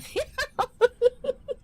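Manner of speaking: squealing high laugh. Real, not acted. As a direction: break into a shrill-free high laugh. Laughs at own joke.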